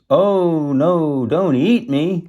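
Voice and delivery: loud, high goat plea; hammed-up pleading; begging. A narrator's reading. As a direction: beg for your life, storybook style. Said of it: "begged the second goat".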